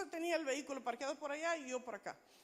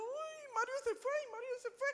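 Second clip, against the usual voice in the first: high-pitched repeated lament; hammed-up dismay at being left; retold panic; 11 semitones higher than usual. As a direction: fret in a high, acted whine. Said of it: "Yo solo pensaba".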